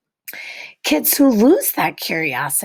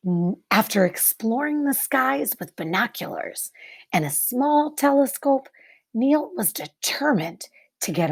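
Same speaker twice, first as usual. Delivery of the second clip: put-on reading to children; 2 semitones higher than usual. Teacher reading a picture book to the class, hushed and wondering.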